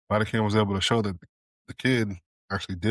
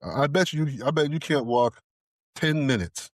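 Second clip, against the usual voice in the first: higher, lively mock dare; teasing challenge; 6 semitones higher than usual. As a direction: rib him, betting he can't.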